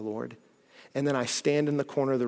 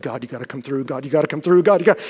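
nervous pleading; 8 dB louder, faster than usual. Acted. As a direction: act out mounting panic in a prayer. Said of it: "get so nervous before talks".

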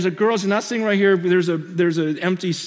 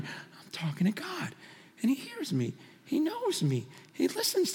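breathy, awed whisper, much softer; preacher hushes in awe before God; hushed whisper; 8 semitones higher, 9 dB quieter than usual. Real, not acted. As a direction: drop to a whisper in wonder. Awed hush about talking to God.

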